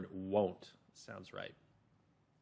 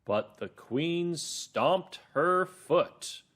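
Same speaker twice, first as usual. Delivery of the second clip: very slow, higher-pitched dramatic narration; storybook narrator; 6 semitones higher, 3 dB louder, much slower than usual. Acted. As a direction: narrate it word by word, with great emphasis. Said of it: "So narrator two again".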